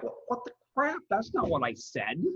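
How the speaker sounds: high-pitched startled what the crap; exasperated disbelief. Retold or imitated. Student mocking autocorrect's stupid change, high disbelief. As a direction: squeal it out, baffled by autocorrect. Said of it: "and you're like".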